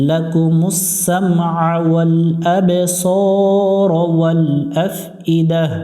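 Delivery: very slow chanted recitation; recited phrase. Shown as a demonstration. Teacher models the verse part, slow chant. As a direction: stretch the vowels like a reciter.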